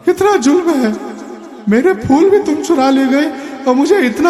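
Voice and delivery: put-on high, whiny old man's plea; high tearful whimper of a frail old man; whining, tearful. A narrator's reading. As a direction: wail it like a weepy old man. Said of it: "बड़े ही रोनी आवाज में बोला".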